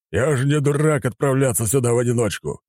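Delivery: high-pitched gruff admiral's retort; barking. A narrator's reading. Admiral who overheard the worried report, barking. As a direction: bark back the reassurance. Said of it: "рявкнул Нахимов".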